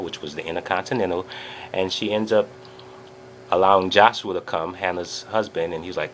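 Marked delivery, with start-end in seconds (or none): none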